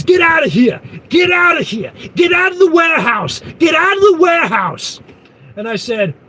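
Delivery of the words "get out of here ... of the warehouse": much higher, loud repeated hammed-up shouting; mock yelling. Angry man chasing the trucker out of the warehouse, high mock shout. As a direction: bellow it over and over like a maniac. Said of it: "He's yelling".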